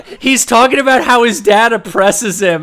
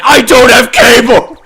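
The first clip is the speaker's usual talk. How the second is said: higher, much louder screamed excuse; hammed-up higher, louder scream; mock scream; 6 semitones higher, 11 dB louder than usual. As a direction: wail it in desperation. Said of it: "the way he screams".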